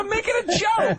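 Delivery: high protesting shout. Real, not acted. Protesting in argument.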